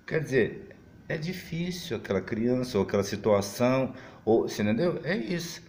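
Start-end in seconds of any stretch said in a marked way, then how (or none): none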